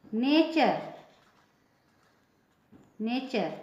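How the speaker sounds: "नेचर" ending in char; "नेचर" modelled for learners with a clear ch; TURE as "char". Shown as a demonstration. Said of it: "इसको नेचर पढ़ेंगे".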